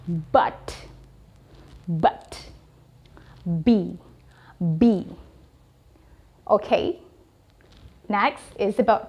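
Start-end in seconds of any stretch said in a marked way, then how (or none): 0.1-5.1 but and B low with a voiced b; model pronunciation. Shown as a demonstration. Teacher showing the voiced b. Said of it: "dia lebih voiced ya".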